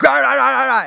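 high-pitched mock yelling in gibberish; Gordon Ramsay. Preacher mimicking chef in commercial, high mock yelling. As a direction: rant wordlessly like an enraged TV chef. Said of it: "always yelling at people in the kitchen".

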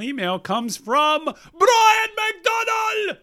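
slow build to a high shouted name; hammed-up announcer shout. Host announcing the final emailer, hammed-up announcer shout. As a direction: climb steadily, then belt out the name like a ring announcer.

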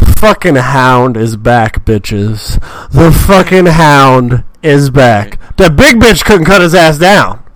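slow, loud announcer-style hype; booming hype call, stretched out; hype shout. Acted. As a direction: drag it out like a ring announcer. Hype intro for the Hound.

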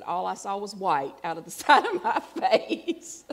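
loud, laughing through it; laughing quote. Retold or imitated. Laughing while quoting the ex-husband to the congregation. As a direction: retell the ex's line laughing. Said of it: "My ex-husband would say later".

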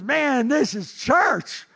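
imitating a crazed fan, high and drawn out; high, slow, exuberant mock holler; retold wild excitement. Football fan raving to a stranger in the stands, wildly excited. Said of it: "he was going nuts".

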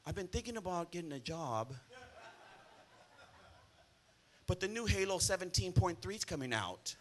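lazy gamer son. Retold or imitated. Pastor mimics a gamer son, laughing mockery.

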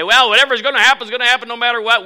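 high, fast, loud mock Calvinist; hammed-up fatalist, fast and high. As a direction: lampoon the Calvinist, shrugging it off. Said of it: "to be a Calvinist and just say".

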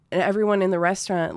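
ordinary delivery

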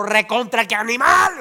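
slow, loud shouted retort; retold shouted comeback. Other driver shouting back, slow loud comeback. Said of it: "Y el otro".

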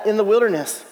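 usual speaking voice

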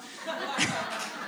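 quick unvoiced giggle, high; breathy giggle. Real, not acted. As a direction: let a silent giggle escape. Laughs at his own joke.

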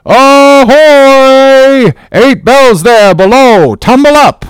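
high, loud hogshead-mouth calling; bawling sailor. Acted. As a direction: roar the watch awake. Second sailor calls the watch.

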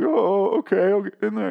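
high-pitched, whiny mock sobbing; mock crying. Mocking a character who's told to get in there, fake crying. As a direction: imitate a crybaby giving in, voice way up. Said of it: "he's, like, just crying".

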